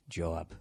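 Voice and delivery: "job" said as jaw plus b; low, Boston-style "job". Shown as a demonstration. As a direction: put an aw vowel in "job". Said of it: "and then B".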